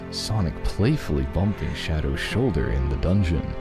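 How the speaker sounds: usual speaking voice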